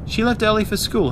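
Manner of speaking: high, light motherly voice. A narrator's reading. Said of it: "her mother said".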